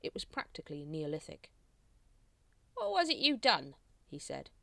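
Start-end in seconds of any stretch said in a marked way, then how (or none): 2.7-3.7 high-pitched, louder guard voice; a guard demanding an answer, pitch leaping up; questioning guard. A narrator's reading. Guard gruffly questioning new recruit.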